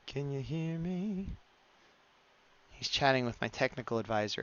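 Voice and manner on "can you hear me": very slow, softer and higher call; drawn-out calling. Pretend. Streamer playfully calling to a chatter with connection trouble, drawn-out calling. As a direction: stretch every word, lifting the pitch toward the end.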